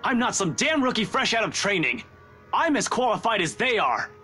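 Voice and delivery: high-pitched, affronted insistence on being taken seriously; indignant protest. Acted. Detective defending qualifications to the chief, indignant. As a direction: raise the pitch sharply in heated self-defence.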